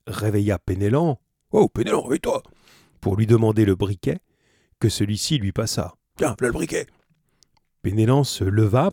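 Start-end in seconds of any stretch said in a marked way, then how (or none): 1.5-2.4 voix de personnage bien plus aiguë, qui appelle; réveille ton compagnon d'un cri aigu; appel pressant. Acted. Jean réveille Penellan dans le noir. 6.2-6.9 voix de vieux marin populaire, plus aiguë; marin bourru. Acted. Penellan répond en tendant le briquet.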